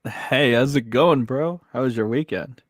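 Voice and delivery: normal talking voice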